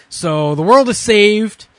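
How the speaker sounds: loud, slow, triumphant; grand announcement. Real, not acted.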